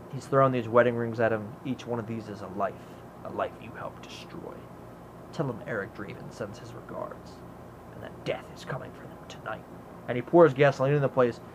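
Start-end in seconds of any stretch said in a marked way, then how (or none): 1.7-9.6 low, very soft, near-whispered menace; hushed threat. Retold or imitated. The crow warns the pawnbroker, imitated hushed threat. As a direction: imitate a quiet, deadly warning.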